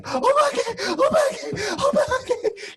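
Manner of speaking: very high, loud repeated Obake; terrified screaming. Retold or imitated. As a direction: shriek in panic at the ghost. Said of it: "she whacking him".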